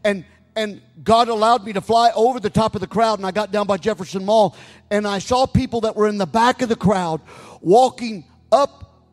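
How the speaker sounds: loud preacher stretching out the key phrases; full-force preaching. Retold or imitated. Pastor retelling a woman's dream to his congregation, preached loudly.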